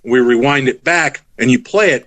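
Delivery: ordinary talk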